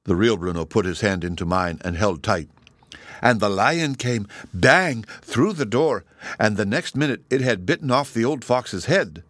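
ordinary delivery

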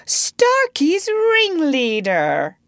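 high, slow, drawn-out accusation; menacingly pleasant. A narrator's reading. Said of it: "more pleasantly than ever".

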